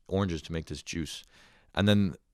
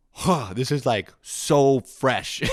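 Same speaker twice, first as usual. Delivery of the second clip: drawn-out mock wonder; 7 semitones higher, 5 dB louder, much slower than usual.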